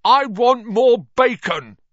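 very high, loud, slow whine; demanding spoilt son. A narrator's reading. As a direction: bellow like a spoilt child. Greedy son Dudley demands food.

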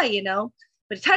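ordinary talk, nothing marked

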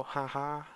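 a polite fake chuckle, barely voiced; forced little laugh. Brushing off a teasing stranger with a faked little laugh. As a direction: cover the hurt with a tiny laugh. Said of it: "give a little".

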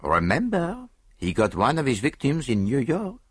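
high, teasing with a smile; a sly parting shot; smiling sly warning; peculiar smile. A narrator's reading. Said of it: "said with a peculiar smile".

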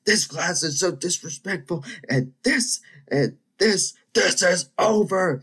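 mock tirade building to a drawn-out final shout; worked-up teacher. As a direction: imitate a teacher losing it, high and loud. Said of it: "she starts getting all worked up".

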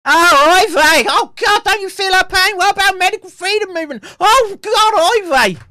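very high, loud hammed-up wail; mock wailing. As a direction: shriek it as an overblown mock lament. Mock lament, acted outcry.

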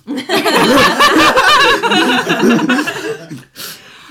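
high squealing laugh; shrieking laughter. Real, not acted.

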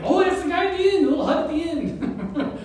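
high, loud, laughing helpful local; a hammed-up villager pointing the way; chirpy villager. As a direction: toss it off cheerfully as a villager. Villager answers, voiced by preacher.

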